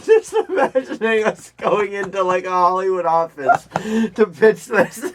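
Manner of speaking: amused, laugh bubbling under the words. Real, not acted. Co-host imagining a Hollywood pitch, laughing.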